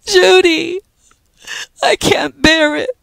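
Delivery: high, loud crying plea; weeping. A narrator's reading. Pat weeping in Judy's arms, crying out. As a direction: sob it out to Judy. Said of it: "Pat was weeping wildly".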